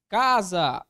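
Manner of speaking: "Casa" very loud, CA held and stressed; stressed syllable demo. Shown as a demonstration. Teacher asking where the tonic syllable is, loud stress on CA in "Casa". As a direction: call out CA-sa, hitting the CA hard. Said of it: "onde que está a minha sílaba tônica".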